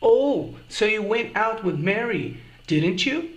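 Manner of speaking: soft, slow statement, tag rising up on "didn't you?"; "didn't you?" lifted up like a real question; gentle indirect question, "you" rising; rising tag intonation. Shown as a demonstration. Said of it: "Percebeu a diferença na entonação".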